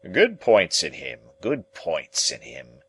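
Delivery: slow, grudging gentleman. A narrator's reading. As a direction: concede it slowly. Gentleman character voiced.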